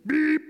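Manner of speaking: short high-pitched beep; squeaky little horn beep; truck beep. A narrator's reading. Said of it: "said Blue".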